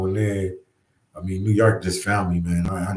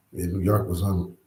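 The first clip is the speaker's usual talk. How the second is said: usual speaking voice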